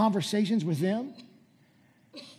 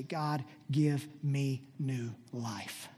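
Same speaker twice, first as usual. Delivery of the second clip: slow solemn plea; 3 semitones lower, much slower than usual. Acted. Pastor modelling a cry to God, slow solemn plea.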